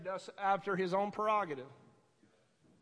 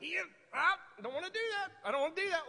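higher-pitched mock reluctance; whiny refusal; 5 semitones higher, much faster than usual. Preacher imitating people who balk at God's will, whiny refusal. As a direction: mimic people balking, quick and high. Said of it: "a lot of people's like".

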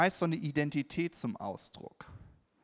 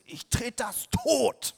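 much higher, slow mock fury; mock rage; 10 semitones higher, 5 dB louder, slower than usual. Parent's inner voice raging at creative kids, mock fury. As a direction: spit it out in hammed-up rage.

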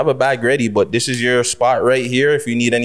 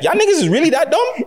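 much higher, incredulous jab; exasperated. Real, not acted. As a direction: jab at the critics, disbelieving. Mocking commenters' robe claims.

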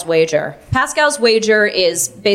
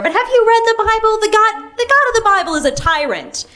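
high-pitched, heated outburst; annoyed; 13 semitones higher than usual. Retold or imitated. Sibling attacking the Bible's God in a debate, annoyed. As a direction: argue back, pitch shooting right up. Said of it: "And I got annoyed".

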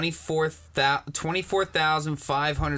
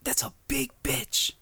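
awed whisper; 10 semitones higher than usual. Real, not acted. Awed whisper about the pool.

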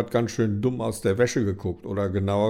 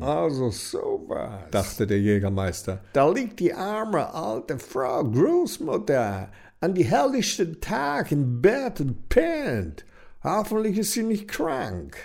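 hunter voice; 6 semitones higher than usual. A narrator's reading. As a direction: muse aloud like a fussy gentleman.

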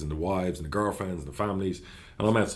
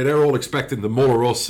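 ordinary talk, nothing marked

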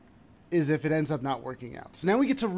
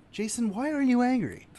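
self interrogation; 10 semitones higher than usual. Retold or imitated. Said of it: "I had to ask".

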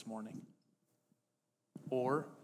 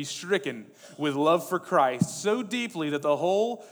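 full-force preaching; 5 semitones higher, 11 dB louder than usual. Acted. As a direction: hammer each paired verb at the congregation. Declaims Tozer quote at full force.

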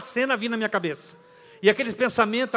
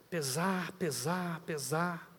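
each "pesar" softer than the last; fading repetition; 3 semitones lower, 11 dB quieter, much slower than usual. Acted. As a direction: let the word sink away. Guilt growing heavier. ("pesar" repetido, cada vez mais baixo)

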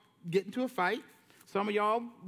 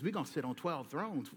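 lower, softer Jesus promise; a hint of Jesus, low and soft; lightly voiced Jesus; 8 semitones lower, 8 dB quieter than usual. Jesus promising the disciples thrones, low and soft. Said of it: "Jesus says".

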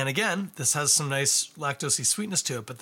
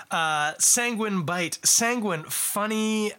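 sanguine stretched out slowly, louder and higher; savoured fancy word; 8 semitones higher, 4 dB louder, much slower than usual. Shown as a demonstration. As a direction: relish the word sanguine, drawn out. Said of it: "bust out some real seven dollar words".

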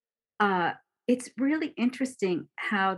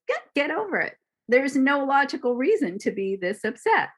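much higher, louder dismissive scolding; judging logical mind; 6 semitones higher, 4 dB louder, faster than usual. Retold or imitated. Teacher voicing the judging thinking mind, impatient scolding. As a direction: brush the feelings off, impatient. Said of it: "judges it and goes".

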